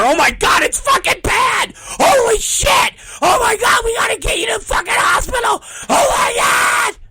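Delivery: hammed-up high screaming alarm; mock panic. Promoter showing onlookers how not to tell her, mock panic. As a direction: scream it loud and high, exaggerating the horror.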